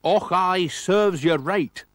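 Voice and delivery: high, loud, slow snarl in a put-on Scottish voice; lorry driver sneering at the crashed motorist. A narrator's reading. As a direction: gloat nastily with a fake Scottish accent. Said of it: "snarled the lorry driver".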